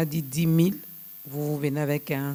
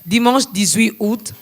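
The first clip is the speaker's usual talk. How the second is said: loud, slow, raised announcement; emphatic restatement; 5 semitones higher, 8 dB louder than usual. Real, not acted. Host finally getting the date right, loud emphatic announcement. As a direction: announce the corrected date firmly and slowly.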